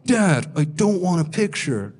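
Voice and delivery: put-on slow, drawn-out child's protest; imitated kid complaining to dad; reluctant kid. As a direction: drag it out like an unwilling kid. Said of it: "And the kid goes".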